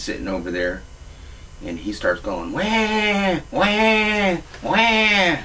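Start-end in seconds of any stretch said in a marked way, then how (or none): 2.5-5.4 put-on high, drawn-out baby wail; three long imitation baby wahs; mock baby cry. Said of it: "crying like a little goddamn baby".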